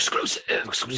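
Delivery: breathy whispered "Exclusive, exclusive"; whispered echo. Pretend. Host whispers "exclusive" back at the singer as a joke. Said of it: "again, an exclusive".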